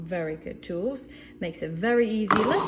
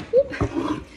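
quick high-pitched whoop; startled whoop; 5 dB louder than usual. Real, not acted. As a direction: let out a sudden high "whoop". Small startled exclamation while handling boards.

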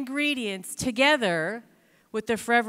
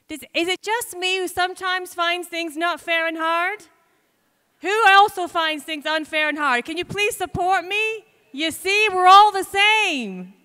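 higher, louder mock whining plea; playful mock complaint, higher and louder; mock whine; 6 semitones higher, 8 dB louder than usual. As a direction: ham up the self-pitying moan. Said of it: "mock whining plea to audience".

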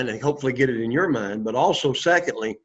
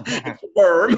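laughing; 5 semitones higher than usual. Real, not acted. Teacher laughs at obvious answer.

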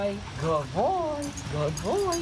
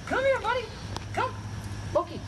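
very high squeaky dog voice; sing-song high call to a puppy; squeaky praising a dog. Real, not acted. Trainer calling dog to come.

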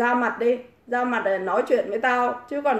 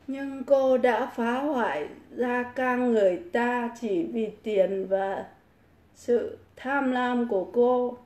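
slow, softer read-aloud; 5 dB quieter, slower than usual. Real, not acted. Streamer reading a viewer's accusation aloud, slow reading. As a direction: read it out slowly in halting chunks.

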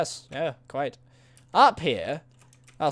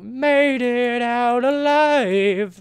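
dramatic; 6 semitones higher, 4 dB louder, much slower than usual. Pretend. Dramatic escape from mine.

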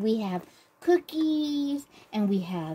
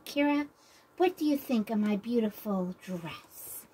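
usual speaking voice

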